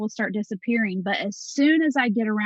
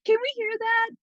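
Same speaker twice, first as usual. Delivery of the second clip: imitating intrigued foreigners, pitched up; curious questioning; 10 semitones higher than usual. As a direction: lightly mimic listeners puzzled by her accent. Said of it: "have people questioning".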